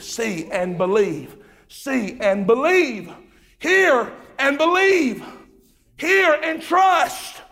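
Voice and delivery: slow, rising to a high loud shout; building to a shout. Real, not acted. Preacher pleading with listeners to believe, building to a shout.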